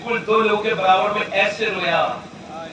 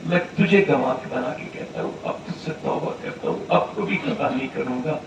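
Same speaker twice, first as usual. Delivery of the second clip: broken tearful plea; 5 semitones lower, 7 dB quieter than usual. Acted. Sinner weeping quietly for forgiveness, soft broken plea. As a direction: plead through tears, barely voiced. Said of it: "پھر اور پھوٹ کے رویا".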